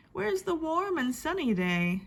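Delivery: plain reading voice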